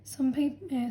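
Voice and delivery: normal talking voice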